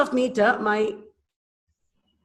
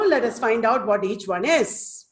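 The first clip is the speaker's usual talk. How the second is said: usual speaking voice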